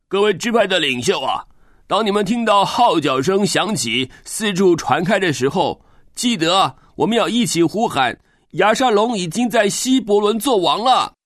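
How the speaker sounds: high, eager call to the tribes; rallying messenger. Acted.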